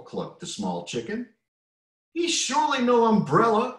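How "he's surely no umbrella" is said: high, loud hen cluck. A narrator's reading. Smaller chicken praising the big chick, clucking. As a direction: cluck it proudly. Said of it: "clucked the smaller chicken".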